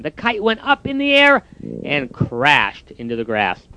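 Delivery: ordinary delivery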